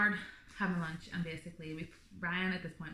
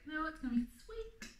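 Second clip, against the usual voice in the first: high-pitched show of being fine; mock reassurance, pitched well up; trying not to stress; 10 semitones higher than usual. Expectant mother insisting she isn't worked up, forced calm. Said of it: "trying, like, not to stress, not to get worked up".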